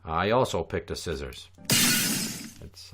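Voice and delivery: usual speaking voice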